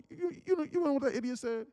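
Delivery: lightly voiced younger brother, eager to tell; imitating brother. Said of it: "he was like".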